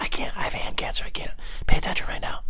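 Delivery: hushed whisper to a neighbour. Pretend. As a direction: whisper so the comic won't hear. Whispering back during a show.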